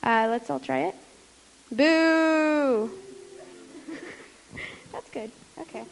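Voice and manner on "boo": loud ghostly boo; startling boo. Shown as a demonstration. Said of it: "make a boo sound when you see this card".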